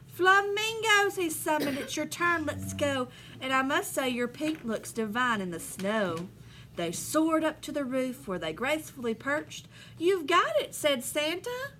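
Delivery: lively storybook read-aloud; reading to children. Acted. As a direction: read the rhyme to the class with bounce. Teacher reads picture book aloud.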